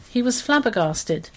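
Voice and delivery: ordinary delivery, nothing marked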